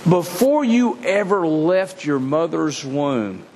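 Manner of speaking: ordinary delivery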